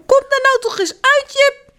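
mama roept hoog en ongeduldig over het strand; calling out. Acted. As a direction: roep hem hoog uit het water. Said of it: "staat al heel lang te roepen". (ongeduldig roepen)

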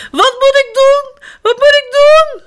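high, loud frantic cries; panicked. A narrator's reading. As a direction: shriek it in panic. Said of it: "roept ze in paniek". (in paniek roepen)

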